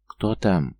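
plain reading voice

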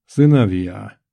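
big, commanding call to attention; voice filling the room. A narrator's reading. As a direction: project it like a patriarch opening a speech. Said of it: "его голос заполнил всё пространство".

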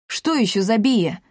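usual speaking voice

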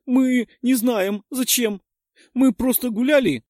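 put-on high, loud girl, stumbling on purpose; deliberately halting. A narrator's reading. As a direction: fake a faltering frightened explanation. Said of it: "нарочито сбивчиво начала объяснять".

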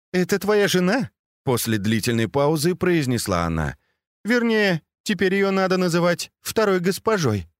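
молодая служанка. Acted. Си Жень сдерживает смех.

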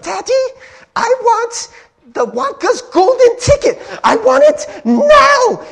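put-on spoiled girl's high, loud demand, building to a drawn-out yell; Veruca Salt. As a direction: throw a bratty tantrum in bad British. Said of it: "little girl just yelling".

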